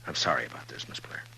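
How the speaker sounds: whispered, soft condolence; quiet sorrow to a grieving sister; hushed sympathy. Acted. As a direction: barely voice it, out of respect. Marshal consoling grieving sister.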